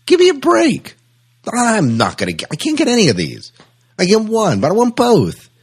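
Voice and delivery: mock-indignant shopper whining about cost; outraged disbelief. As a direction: squeal at the prices like you've been robbed. Acted shopper shocked at prices.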